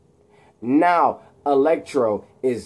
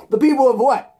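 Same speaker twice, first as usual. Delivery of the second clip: mock demand; 11 semitones higher, 5 dB louder than usual. Eddie demanding repeat.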